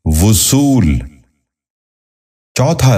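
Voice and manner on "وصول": وصول said as "vu-sool", short u after v; correct pronunciation. Shown as a demonstration. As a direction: open وصول with "vu". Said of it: "درست تلفظ ہے".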